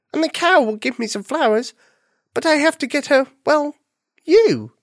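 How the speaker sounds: high-pitched, urgent little bunny; bunny in a rush. A narrator's reading. Bunny explaining his trouble to the dog, urgent. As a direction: blurt it all out in a high bunny voice. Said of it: "Buffy said in a rush".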